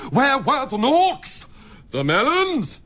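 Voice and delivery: builds slowly to a high, gleeful shout on boobies; leering excitement. Acted. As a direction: stretch each word out, climbing into a shout. Randy doctor crowing about breasts.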